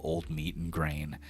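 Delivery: ordinary talk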